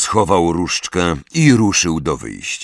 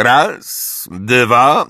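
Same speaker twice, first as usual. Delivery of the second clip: slow count at a raised pitch; drawling, higher-pitched countdown; bored counting; 5 semitones higher, 3 dB louder than usual. A narrator's reading. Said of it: "powiedział znudzonym głosem Snape".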